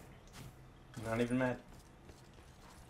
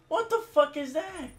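high, furious disbelief; outraged yell. Real, not acted. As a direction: yell it way up at the game. Raging gamer at game.

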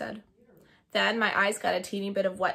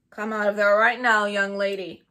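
loud stern order; stern command; 10 dB louder than usual. Acted. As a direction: bark it like a cross principal. Principal orders her out.

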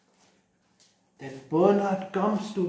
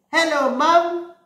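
put-on high, loud little-boy hello; child calling to his mum; little boy greeting; 11 semitones higher, 6 dB louder than usual. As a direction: sing it up like an eager small child. Bernard greets his mother.

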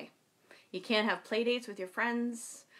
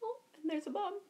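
ordinary delivery

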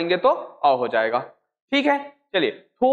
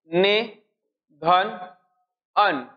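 ने, धन, अन with long pauses between; slow syllable-by-syllable; 3 semitones lower, much slower than usual. Shown as a demonstration. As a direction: break the word into parts for the class. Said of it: "क्या बोला मैं?".

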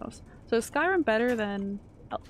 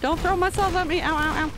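high, loud protest under fire; panicked yelling; 7 semitones higher, 7 dB louder than usual. Real, not acted. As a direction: yell at the attacker, then yelp in quick bursts. Under attack in game.

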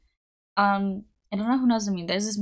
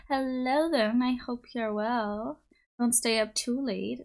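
usual speaking voice

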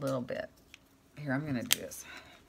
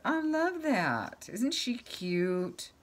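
much higher, very slow cute coo; cooing delight; 9 semitones higher, much slower than usual. Real, not acted. Crafter gushes over her painted figure, high slow coo.

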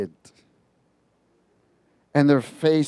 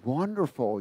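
soft, whispered flattery from the shadow; gentle demon voice; 2 semitones lower, 7 dB quieter, slower than usual. Retold or imitated. Retelling the devil's voice in the van, soft and sly. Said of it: "was real gentle".

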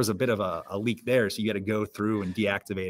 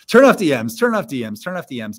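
high first burst, then easing down; nagging reminder bot; 5 semitones higher, 9 dB louder than usual. Acted. Bot reminding server members about scams, insistent and repetitive. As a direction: repeat it insistently like an automated reminder. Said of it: "constantly reminding a bot that's just like".

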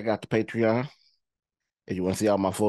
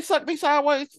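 incredulous quote. Retold or imitated. Quoting the movie character, high and incredulous. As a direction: repeat the line high with disbelief. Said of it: "Did he say".

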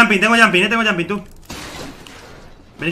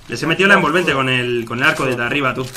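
normal talking voice

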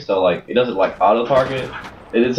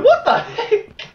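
mock surprised voice; slower than usual. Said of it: "He was like".

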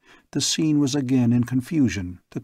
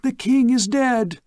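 much higher, louder, slow scream; girl screaming; 12 semitones higher, 4 dB louder than usual. Acted. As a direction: scream it out in horror. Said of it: "A girl screaming and then shouting".

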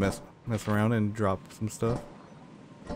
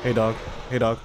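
calling out; 2 semitones higher, 3 dB louder than usual. Real, not acted. Calling a dog in game.

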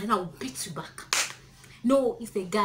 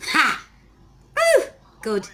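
shocked reaction; 6 semitones higher, 8 dB louder, slower than usual. Real, not acted. Reacting to slap on video.

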